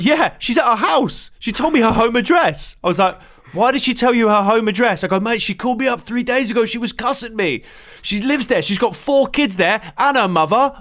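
retold tube woman bragging, pitched high; tube woman boasting. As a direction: play her cheerfully boasting about the fight.

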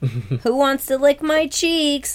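high, slow creepy voice; creepy high slow offer; creepy Kevin voice. Retold or imitated.

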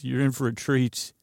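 usual speaking voice

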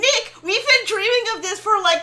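imitating a thrilled friend, high and loud; excited shout. To his friend, mimicking Zach's glee at the island, loud and high. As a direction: burst out with wide-eyed joy, high and loud. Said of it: "Zach's, like, hands against the glass".

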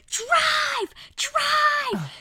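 very high, very loud panicked scream; screamed drive. Retold or imitated. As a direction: scream it at the driver to get away. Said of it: "I screamed".

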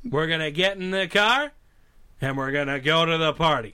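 put-on supposed Boston accent that stays pure cowboy star; John Wayne. Star failing a requested Boston accent, John Wayne impression. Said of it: "we need you to do a Boston accent".

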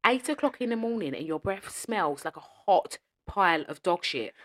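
ordinary talk

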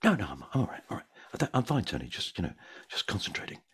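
put-on young actor hiding stage fright, mildly; nervous denial. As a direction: insist you're fine though you're jittery. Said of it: "Bit, bit nervy, are you?".

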